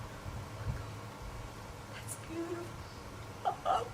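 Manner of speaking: very slow, breath-only whisper; retold awed whisper. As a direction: whisper it slowly, overcome by the story. Moved reaction to beautiful story, retold.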